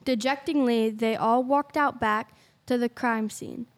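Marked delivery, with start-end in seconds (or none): none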